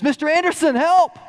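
high, urgent shout across the river; a boy shouting for rescue; calling for help. Retold or imitated. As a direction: yell for help to the rancher.

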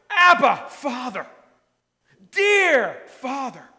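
loud high cry, slowing on "dear Father"; fervent loud cry. Shown as a demonstration. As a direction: cry it out with full force, then stretch "dear". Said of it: "empowers us to cry out".

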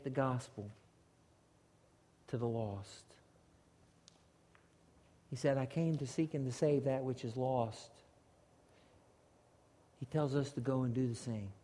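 soft, low, halting pulpit delivery; hushed, grave appeal to the congregation; solemn preaching. Real, not acted. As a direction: let it sink in, quietly, with long pauses. Solemn close of the sermon.

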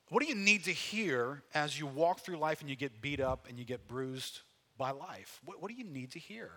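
usual speaking voice

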